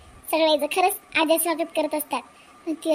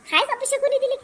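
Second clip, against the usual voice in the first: angry insistence; 9 semitones higher than usual. Acted. Mother-in-law insists angrily.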